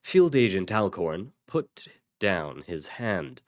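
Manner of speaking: ordinary delivery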